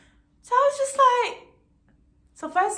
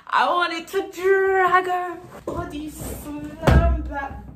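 higher, louder, slow seething rage; furious; 6 semitones higher, 4 dB louder, slower than usual. Retold or imitated. Telling friends about the rude agent, slow furious rant. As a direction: drag it out like you could explode. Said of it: "I was so annoyed".

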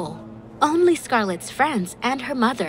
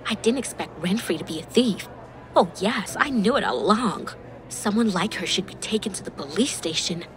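usual speaking voice

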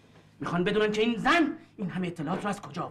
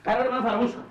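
angry order; 6 semitones higher, 3 dB louder than usual. Acted. Ordering her to flee.

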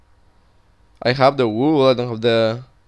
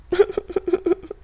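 high giggle; 5 dB quieter than usual. Real, not acted. After losing the pets.

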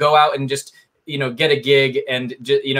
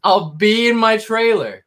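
high, drawn-out mimicry of a parting line; grand mock sign-off, slow and high; mock exit line; 7 semitones higher than usual. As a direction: stretch it out in a raised, teasing imitation. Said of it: "He just winked and literally disappeared".

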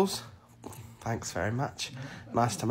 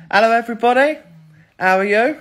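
big, raised-voice hello; loud greeting; 6 semitones higher, 10 dB louder than usual. Real, not acted. Restarting the greeting to viewers after interruption, loud. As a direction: greet everybody loudly.

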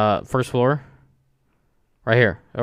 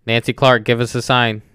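usual speaking voice

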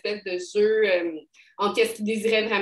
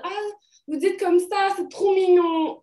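high, louder gushing imitation; imitated patronizing admiration, pitched up; condescending; 9 semitones higher, 4 dB louder than usual. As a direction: coo in mock delight, talking down. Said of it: "c'est très condescendant finalement".

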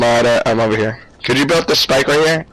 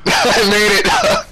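high, drawn-out words through laughter; laughing relief; 6 semitones higher, much slower than usual. Real, not acted. Player who just made it through alive, laughing. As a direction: laugh it out, amazed to survive. Laughing after narrow escape.

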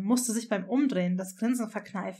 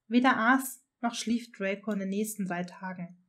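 ordinary delivery

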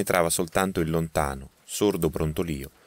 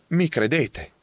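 higher, startled question; wide-eyed astonished cry; 10 semitones higher, 4 dB louder, slower than usual. A narrator's reading. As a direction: cry out in disbelief. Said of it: "gridò, sbarrando gli occhi dallo stupore".

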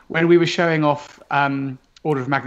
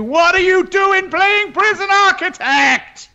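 mock outraged shout; 5 dB louder than usual. Mock trailer outburst.

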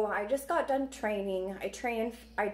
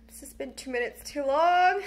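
louder, half laugh half sob; embarrassed laugh while still crying; laughing through tears; 4 semitones higher, 8 dB louder than usual. Real, not acted.

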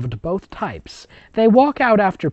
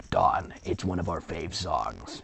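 put-on low, soft, half-whispered girl voice; 10 semitones lower, 9 dB quieter than usual. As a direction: coo it quietly like a teen girl. Voicing the girl in story.

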